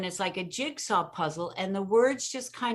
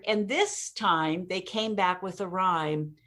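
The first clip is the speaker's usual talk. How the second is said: normal talking voice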